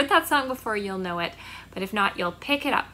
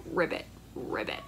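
low croaky ribbit; 7 dB quieter, faster than usual. Shown as a demonstration. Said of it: "frogs croaking".

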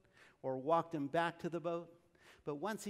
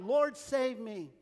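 slow, louder plea for rescue; crying out; 4 semitones higher, 6 dB louder, much slower than usual. A narrator's reading. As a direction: call out slowly for help as you sink. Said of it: "he cried out".

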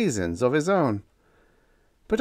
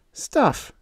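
sniffed; 14 semitones higher than usual. A narrator's reading. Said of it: "sniffed Diane".

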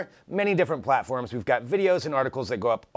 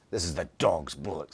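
lower, softer mock British accent; 6 semitones lower, 4 dB quieter than usual. Host retries the line in a lower British accent. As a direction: try it again as a deeper British voice. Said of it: "terrible British accent".

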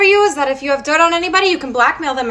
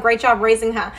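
sarcastic laugh. Acted.

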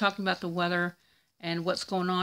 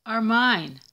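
proclaiming Jesus; 10 dB louder, much slower than usual. Retold or imitated. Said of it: "Jesus appeared ... and said".

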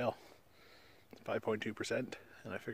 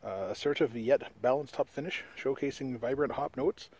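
reading a beer label aloud, a bit louder; 3 semitones higher, 5 dB louder than usual. Acted. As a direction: read it like a product blurb.

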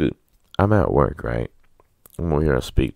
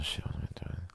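whispered to himself; 15 dB quieter than usual. Real, not acted. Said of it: "muttered to himself".

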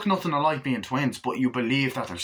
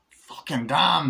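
normal talking voice

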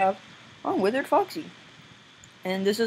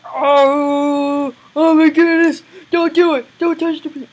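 loud, high mock panic; panicked pleading; 8 semitones higher, 11 dB louder, slower than usual. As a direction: wail in hammed-up alarm. Acted panicked plea in game.

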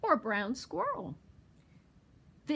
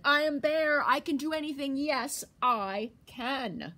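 loud, higher booming bear boast; proud Bear; 6 semitones higher, 6 dB louder than usual. Acted. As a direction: stand tall and boast.

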